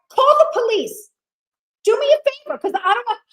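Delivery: high, loud retold demand. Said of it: "now I start to raise my voice".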